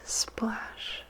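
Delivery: ordinary delivery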